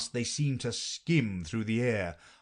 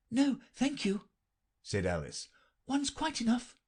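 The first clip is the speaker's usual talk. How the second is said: polite Alice; 13 semitones higher than usual. A narrator's reading. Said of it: "Alice declining".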